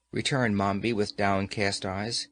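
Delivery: ordinary delivery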